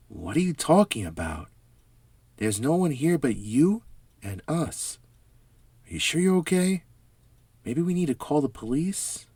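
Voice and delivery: fussing over a hurt friend; worried neighbor. Acted. Worried neighbors voiced by narrator.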